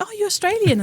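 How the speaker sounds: high, surprised squeak of realization. Retold or imitated. To an Australian acquaintance, high shocked surprise. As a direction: act shocked the person is Australian. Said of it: "I'm like".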